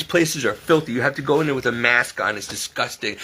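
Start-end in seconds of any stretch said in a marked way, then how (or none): none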